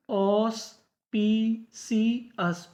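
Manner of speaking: Auspicious said very slowly, each syllable apart; teacher drawing out auspicious; slow careful reading. Shown as a demonstration. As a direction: spell out auspicious by sound for the class. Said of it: "क्या पढ़ते हैं?".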